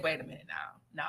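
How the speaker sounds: low, quiet, doubting "Wait a minute now"; a suspicious low murmur of "Wait a minute now"; skeptical. Retold or imitated. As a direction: drop it low and soft, unconvinced. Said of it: "And I said".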